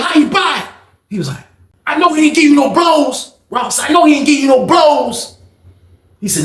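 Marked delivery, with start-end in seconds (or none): 0.0-0.7 high, indignant question; heated challenge. Retold or imitated. Inmate challenging his rival in a standoff, heated. 1.9-5.4 high-pitched disbelief; indignant accusation. Retold or imitated. Rob confronting the Richmond dude in a standoff, indignant.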